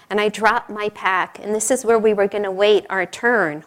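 ordinary talk, nothing marked

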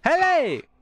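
sudden high yelp of surprise; startled high squeak; startled cry. Real, not acted. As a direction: caught off guard, jump up in pitch. Sudden shock in the game.

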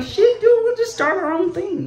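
high-pitched, animated quote; retold exclamation. Comic retells reacting to the market ban, excited. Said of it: "I was like".

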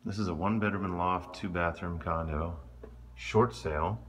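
ordinary delivery

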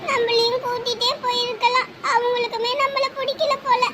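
slow, tearful lament; crying. Acted. Child telling sister that nobody likes them, crying. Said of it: "ஏன் அலற".

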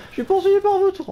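high, loud panicked shout; panicked high yell. Real, not acted. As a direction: cry out while fleeing. Being chased in game.

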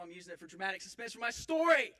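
building to a loud protest; exasperated retort. Acted. Son to interrupting mother, exasperated.

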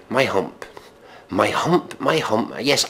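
ordinary talk

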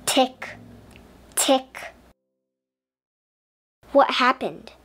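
plain reading voice

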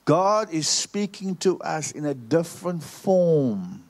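slow, every word weighed and spaced; slow deliberate repeat. Shown as a demonstration. Preacher repeating the line slowly for the congregation. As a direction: say it again, slowly, so it sinks in. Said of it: "I must say that again".

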